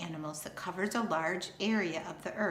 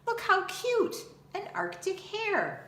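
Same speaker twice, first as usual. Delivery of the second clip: much higher, louder cooing over something cute; delighted squeal; 13 semitones higher, 3 dB louder than usual. Acted. Girls spotting an Arctic hare, gushing delight. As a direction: gush like you've spotted a bunny.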